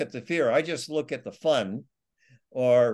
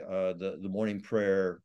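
usual speaking voice

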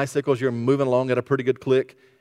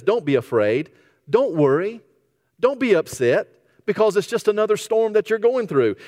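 rising higher and louder, urging with fervour; building reassurance; 7 semitones higher, 3 dB louder than usual. Acted. Jesus urging disciples through the storm, building louder. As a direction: lift them out of their fear. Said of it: "So he says".

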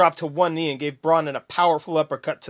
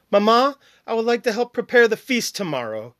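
put-on higher, eager boy voice; timid son voice; 7 semitones higher, 3 dB louder than usual. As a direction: play the earnest young son. Brondon voiced in read story.

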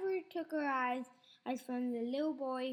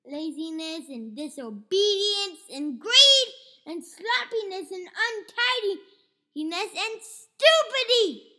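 much louder, higher, listing each fault with scorn; ranting grandma; 5 semitones higher, 14 dB louder than usual. Acted. Grandma rants at George about his bad habits, loud and scornful.

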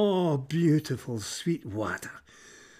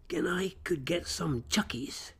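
conspiratorial whisper; 3 semitones higher, 5 dB quieter than usual. Acted. Crow's brainwave, plotting aloud.

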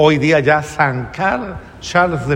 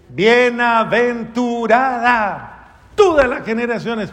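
recited Magnificat; 5 semitones higher than usual. Shown as a demonstration. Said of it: "quién se lo sabe el Magnificat".

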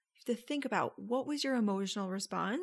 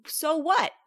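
sharp, high, loud "so what"; a playful scoffing "so what"; mock incredulous; 7 semitones higher, 7 dB louder than usual. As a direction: challenge it with disbelief. Coach mock-challenging listener.

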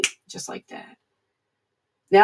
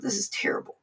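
breathy whisper to self; hushed inner voice at night; whispered thought; 10 dB quieter, faster than usual. Retold or imitated. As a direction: whisper it like a private, groggy thought. Thinking to himself, sleepless night.

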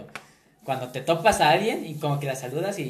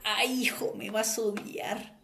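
imitating mom; 6 semitones higher, 5 dB quieter than usual. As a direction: whine it like a sweet forgetful mom. Son imitates his mom. (imitando a mamá con voz aguda y suave)

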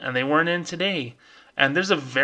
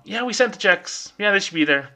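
mock government; 6 semitones higher than usual.

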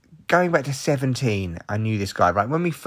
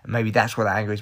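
normal talking voice

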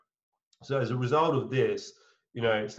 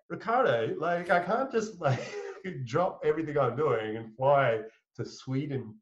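higher, incredulous, laughing through it; laughing protest; 4 semitones higher than usual. Retold or imitated. Telling the founder he can't fly to Sweden tomorrow, half laughing. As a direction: laugh off the absurd request. Refusing founder's sudden trip request.

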